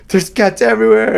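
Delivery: high, loud imitated Jamaican accent. Mimicking client fleeing cats, excited Jamaican accent. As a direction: exclaim like a panicked client, imitating Jamaican speech. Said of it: "in his, like, Jamaican accent".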